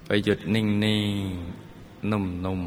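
very slow, stretched words. Acted. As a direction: let each word melt slowly. Drawn-out meditation instruction. (พูดลากเสียงช้าๆ นุ่มๆ)

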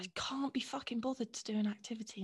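breathy deadpan whisper. Real, not acted. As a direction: drop to a whisper for the swear. Blunt refusal of an activity.

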